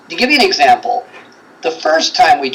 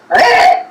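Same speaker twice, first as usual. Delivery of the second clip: mock growl. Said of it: "where somebody goes".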